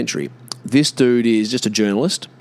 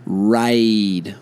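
normal talking voice

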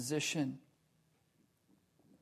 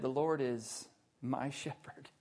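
low and soft, pause before "my"; stress on MY; 3 semitones lower, 7 dB quieter than usual. Shown as a demonstration. Preacher giving David's true wording, stress on "my". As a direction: drop down and set "my" apart. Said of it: "David said".

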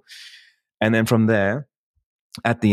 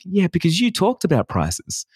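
mock retort, pitched way up; high mock retort; 8 semitones higher than usual. Telling clients why they ask for discounts, high mock voice. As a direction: play the smug reply in a high voice.

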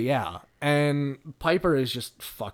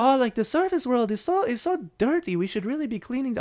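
high-pitched hammed-up French accent; Curie, French accent; 10 semitones higher than usual. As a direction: put on an exaggerated, lilting French voice. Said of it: "this incredibly racist French accent".

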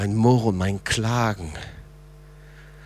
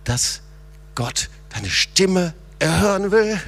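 fervent preaching; 9 semitones higher, 4 dB louder than usual. Acted. Pastor urging the church to cry to the Lord, fervent preaching. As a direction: preach it, calling them out of the pit. Preacher rising to full force.

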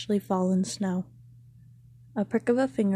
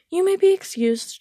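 high, loud, haughty dismissal; a queen shooing away a servant, dismissive; 12 semitones higher, 7 dB louder than usual. A narrator's reading. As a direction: wave him off like a bothersome servant. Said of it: "shooing him away with her hand".

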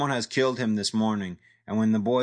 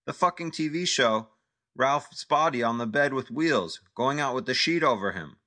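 agitated rant; 7 semitones higher than usual. Acted. Vlade ranting in accent.

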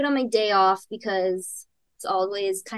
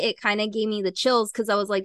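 normal talking voice

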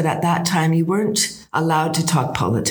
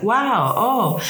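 higher-pitched, drawn-out wow of amazement; impressed wow; 5 semitones higher than usual. Retold or imitated. Traveler hearing the con man's university story, impressed. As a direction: act out being impressed. Acts out her impressed reaction.

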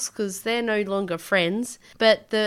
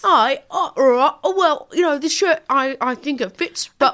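raised, hesitant mock Leeds customer; Leeds accent; 5 semitones higher, 5 dB louder than usual. Customer unsure about a shirt, mock Leeds accent. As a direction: put on a Leeds accent, higher. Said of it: "do the accent".

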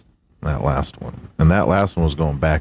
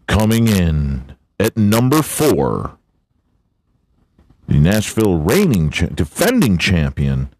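hammed-up race announcer; 6 semitones higher than usual. Reading the NASCAR top finishers to his buddy, hyped announcer. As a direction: boom out the countdown like a race caller. Hams up the race results countdown.

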